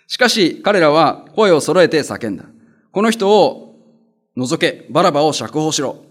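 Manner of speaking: loud, slow formal scripture reading; 聖書朗読. Acted. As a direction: read the verses out to the congregation. (会衆に向けて聖書を朗々と読む)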